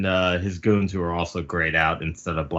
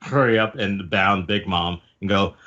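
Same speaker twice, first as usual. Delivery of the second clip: ordinary talk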